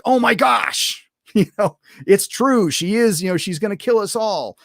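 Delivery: high-pitched mock alarm; mock panic. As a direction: blurt it as a panicked cartoon crewman. Characters panicking in retold scene.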